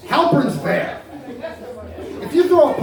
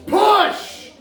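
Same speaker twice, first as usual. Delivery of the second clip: yelling; 9 semitones higher than usual. Retold or imitated. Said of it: "John yelling".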